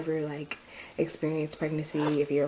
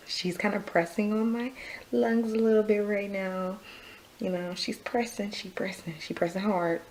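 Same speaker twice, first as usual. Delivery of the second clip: out of breath; 5 semitones higher than usual. Real, not acted. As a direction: talk while struggling for air. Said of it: "sorry if I seem out of breath".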